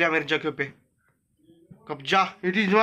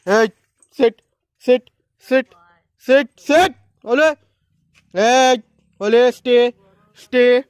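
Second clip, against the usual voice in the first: loud, firm dog commands; 3 semitones higher, 7 dB louder, much slower than usual. Real, not acted. Owner ordering the dog to sit and stay, loud and firm. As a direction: bark the order so the dog sits.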